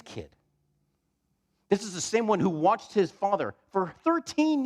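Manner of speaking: high, driving preacher's build-up; impassioned preaching. Real, not acted. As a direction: preach it with mounting, incredulous force.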